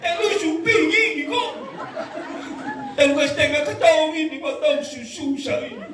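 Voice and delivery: half-laughing mock lament over the dead husband; mock wailing widow. Preacher imitating a widow wailing at the funeral, hammed-up. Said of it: "Pertama dia menangis pelan".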